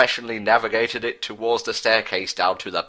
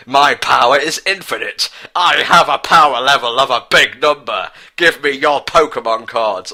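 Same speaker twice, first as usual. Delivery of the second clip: higher and louder boastful villain, puffed up; awesome sexy villain voice; 3 semitones higher, 7 dB louder than usual. Acted. Villain boasting to the frightened friends, loud and puffed up. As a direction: boom it out like a cocky anime villain. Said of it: "he said in his awesome, sexy voice".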